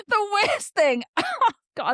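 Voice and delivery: high, giggly, laughing through the words; can't help laughing as you tell it; giggling. Real, not acted. Telling viewers the worst part, giggling. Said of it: "I'm kinda giggling about it now".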